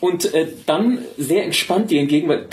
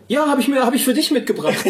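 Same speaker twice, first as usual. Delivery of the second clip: high voiced Jenny quote; 9 semitones higher than usual. Voicing Jenny handing beer.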